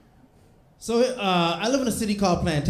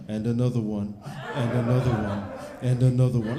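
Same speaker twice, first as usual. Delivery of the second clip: [name]; 4 semitones lower than usual. Retold or imitated. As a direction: boom it out like [name], building each time. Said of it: "like D J Khaled".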